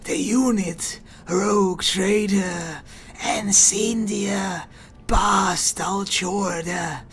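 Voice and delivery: slow, whispery mechanical hiss. Acted. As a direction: hiss each word slowly like a machine priest. Keeps the tech priest voice.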